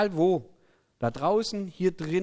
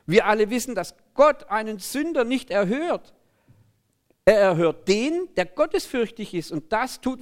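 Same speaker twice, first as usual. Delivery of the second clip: high, emphatic argument; astonished Bible figure making his case; healed man, indignant; 6 semitones higher, 4 dB louder than usual. A narrator's reading. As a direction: argue the point with raised voice. Acts out the healed man's argument.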